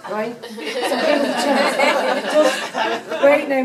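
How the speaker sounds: ordinary talk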